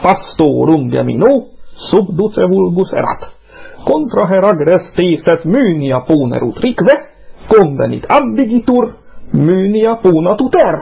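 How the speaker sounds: slow, high-rising metrical chant; scanned Latin recitation. Shown as a demonstration. Reciting Ovid to the other hosts, scanned distichs. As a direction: declaim each half-line with measured pauses. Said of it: "Ezt így kell skandálni".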